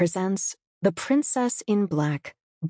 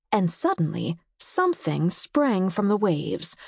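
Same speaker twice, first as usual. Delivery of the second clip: plain reading voice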